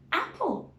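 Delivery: apple with a stretched short A, rising high; short a sound. Shown as a demonstration. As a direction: draw out the A in apple. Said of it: "Can you hear the short A sound?".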